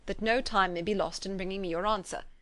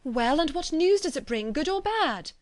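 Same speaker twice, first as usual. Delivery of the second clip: eager; 7 semitones higher, 4 dB louder than usual. A narrator's reading. Eager daughter asking news.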